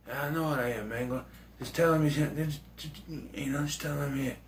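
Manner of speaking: hushed, unbothered reply; Seagal whisper. Retold or imitated. Hatcher unfazed by the gangster, hushed. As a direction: shrug it off softly. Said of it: "He whispers a lot".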